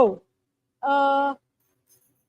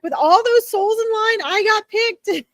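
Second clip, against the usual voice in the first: high, playful mock amazement, slowing at the end; joking excitement; 8 semitones higher, 4 dB louder than usual. Medium passing on dad's joke about getting picked, joking and excited. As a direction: relay his happy joke, pitched well up. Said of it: "He's actually joking around with me".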